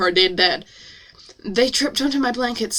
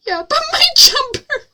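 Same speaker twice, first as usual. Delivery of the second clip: very high, loud, hammed-up whine; mock a crybaby with a squeaky-high wail; mock whining; 5 dB louder than usual. Mocking the heroine crying over jumper.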